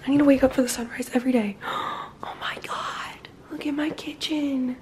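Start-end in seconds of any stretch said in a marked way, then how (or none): 1.6-2.2 gasp. Real, not acted. Sees her kitchen turned pink. 2.2-4.8 slow, hushed whisper of wonder; awed whisper. Real, not acted. Whispering to viewers about her pink kitchen.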